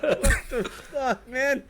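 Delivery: laughing disbelief. Retold or imitated. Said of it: "I'm like".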